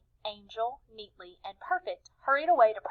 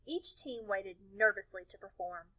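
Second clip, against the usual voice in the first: ordinary delivery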